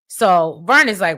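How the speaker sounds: ordinary talk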